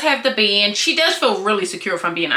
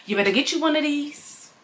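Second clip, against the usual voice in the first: normal talking voice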